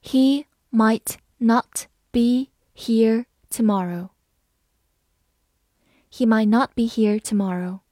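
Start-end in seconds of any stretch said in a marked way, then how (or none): 0.0-4.1 slow, clear word by word reading. Shown as a demonstration. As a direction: read it slowly for learners. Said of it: "好，慢慢来读。". (慢速朗读)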